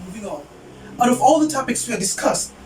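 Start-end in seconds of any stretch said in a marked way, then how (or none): 0.1-0.5 a quiet under-the-breath repeat; muttered aside. Real, not acted. Student telling listeners she's moving past the tangent, muttered softly. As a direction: mumble it to yourself as you move on. Repeats to herself, much softer.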